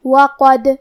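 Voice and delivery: وقد with a bouncing echo on the final dal; qalqala on dal. Shown as a demonstration. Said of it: "ہلاتے ہوئے یہ کلمہ کو پڑھیں گے". (وقد میں دال پر قلقلہ)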